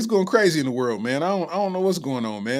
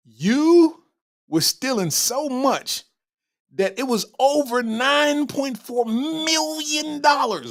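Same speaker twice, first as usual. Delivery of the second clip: higher and slower, every word leaned on in outrage; incredulous rant; 7 semitones higher, slower than usual. Real, not acted. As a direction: pile on the disbelief, stretching it out. Berating the thief in disbelief.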